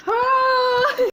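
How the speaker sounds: very high, drawn-out squeal of yes; a long falsetto yes for the pet; squeaky coo. Real, not acted. High cooing to the dog.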